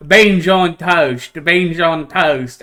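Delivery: slow, deliberate British accent; slow British accent vocal tic; British accent warm-up. Shown as a demonstration. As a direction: drill the British accent back in, slowly. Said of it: "the only way I could get it back was to go".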